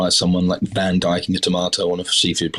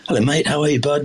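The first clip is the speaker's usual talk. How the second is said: higher, bright matey hello, a bit too familiar; overly chummy greeting; 8 semitones higher than usual. Retold or imitated. Greeting the head chef on day one, cheerily higher and chummy. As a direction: greet the boss like an old pal. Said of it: "I'd come in and say".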